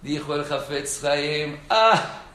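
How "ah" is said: a sudden high, loud "Ah" of wonder; awed exclamation. Retold or imitated. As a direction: marvel out loud at the verse. Rabino exclama admirado. (exclamación de asombro)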